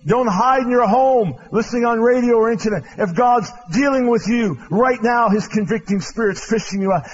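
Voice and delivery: loud, urgent preacher's plea; preaching at full force. Acted. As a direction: press the hearers to respond now. Altar call exhortation.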